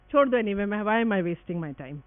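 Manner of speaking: ordinary talk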